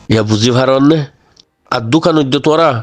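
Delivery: ordinary talk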